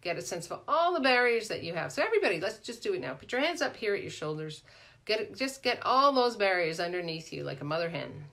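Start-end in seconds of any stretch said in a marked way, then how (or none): none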